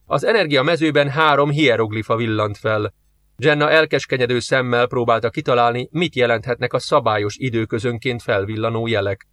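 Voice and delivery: usual speaking voice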